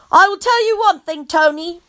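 high, mock shouting child. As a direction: yell it at him lightly. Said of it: "I shouted".

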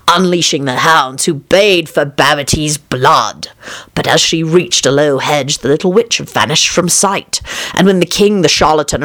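plain reading voice

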